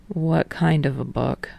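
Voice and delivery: quietly interested; curious, low. A narrator's reading. Tsukishima voiced, interested.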